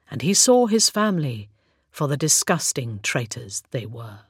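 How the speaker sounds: ordinary delivery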